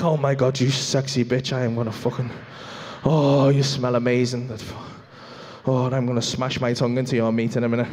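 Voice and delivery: low, mock-seductive croon; lustful affair talk. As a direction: sweet-talk the food like a lover, pitched low. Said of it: "like I was having an affair".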